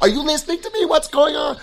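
high-pitched mock nagging; imitating annoyed wife. Wife questioning her zoned-out husband, imitated annoyance. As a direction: pitch jumps up, mock-exasperated demands. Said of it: "my wife gets mad at me".

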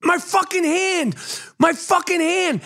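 high, drawn-out re-enacted wail of complaint; pained protest. Host to wife who grabbed his stitches, pained protest.

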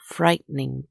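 ordinary delivery, nothing marked

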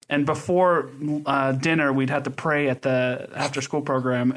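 ordinary talk

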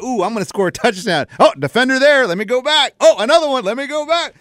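a hint of a dithering ballcarrier; player's inner voice. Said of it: "he's like".

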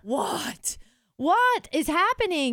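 high, loud, slow alarmed what; shocked disbelief. Retold or imitated. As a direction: reel from the news. Learning the scammer has her info.

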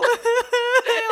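a high, helpless burst of laughter; high laughing shriek. Real, not acted. Telling friends the scary story, high shrieking laugh.

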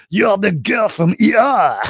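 a Frenchman insisting he knows the actress; excited French accent. Retold or imitated. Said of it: "this guy is like".

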